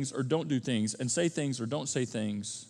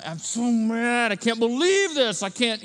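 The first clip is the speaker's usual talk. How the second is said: slow, a bit higher and louder, imitating someone fuming; mock anger; 5 semitones higher, 4 dB louder than usual. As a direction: act out the angry stage of grief, drawn out. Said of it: "And then it gets anger".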